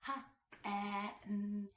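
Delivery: "H-at-n" split into its sounds; sounded out. Shown as a demonstration. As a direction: stretch "H-at-n" apart sound by sound. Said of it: "break down the word in the beginning, middle, and ending sound".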